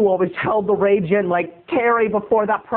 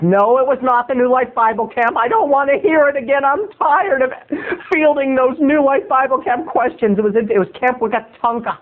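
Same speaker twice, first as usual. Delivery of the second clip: higher-pitched fed-up outburst; mock exasperation; 6 semitones higher than usual. Comedian shuts down tiresome questions about his childhood camp, exasperated. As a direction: sound sick of being asked.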